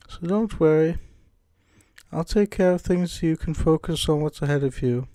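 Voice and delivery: put-on British-accented game character; Monica. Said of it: "can't shake this British accent".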